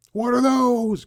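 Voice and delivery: high, loud drawn-out mock outcry; joke shout. Breaking off the lease talk to kid around, joke shout. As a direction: belt it out as a sudden silly gag. Joking outburst, then says kidding.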